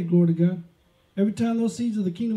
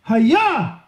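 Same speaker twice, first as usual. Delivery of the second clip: "Hayah!" shouted higher and louder, falling at the end; emphatic proclamation; 5 semitones higher, 7 dB louder than usual. Pretend. Preacher voicing God speaking the first word, with emphasis. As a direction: proclaim "Hayah!" like God's own command. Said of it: "that voice with emphasis".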